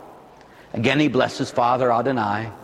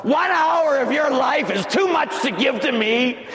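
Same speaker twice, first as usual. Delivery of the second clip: reproachful shout; 7 semitones higher, slower than usual. Acted. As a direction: scold them for sleeping. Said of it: "he looks at him and says".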